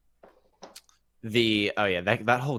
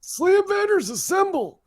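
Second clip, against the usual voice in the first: put-on high, slow creaky old man yell; 6 dB louder than usual. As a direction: bellow it like a cranky old neighbor. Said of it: "I said it in an old man voice".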